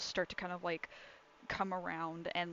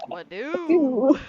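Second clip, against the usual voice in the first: loud, high goofy bellow; dopey cartoon bellow; 6 semitones higher, 14 dB louder than usual. GM bellows what do.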